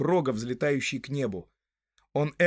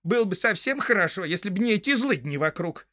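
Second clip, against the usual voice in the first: high put-on woman's voice; farmer's wife; 6 semitones higher, 3 dB louder than usual. A narrator's reading. Farmer's wife grumbling about villains around, high female voice. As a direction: complain as a housewife, pitched up.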